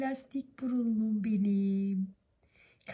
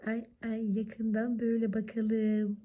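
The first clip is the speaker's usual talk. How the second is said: usual speaking voice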